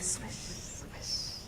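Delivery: breathy whispered swish, swish. Shown as a demonstration. The narrator voices the falling leaves in a whisper. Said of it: "leaves fell on the roof".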